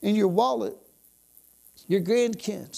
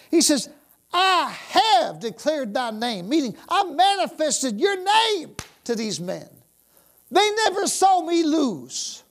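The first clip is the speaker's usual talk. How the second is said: high, loud, fervent preaching; full-force preaching; 6 semitones higher, 4 dB louder than usual. Acted. Preacher rousing the church, full-force fervent preaching. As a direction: preach it with fire.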